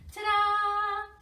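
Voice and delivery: loud, high sung Ta-da!; excited reveal. Acted. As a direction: announce it like a magic trick. Revealing the sound of the day.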